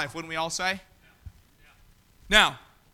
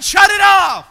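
annoyed shout at a car alarm, loud and higher; irritated yell; 8 semitones higher, 11 dB louder, slower than usual. Retold or imitated. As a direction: bark it at the dummy's car. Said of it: "you wanna yell at 'em".

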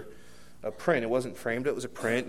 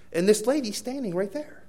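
ordinary talk

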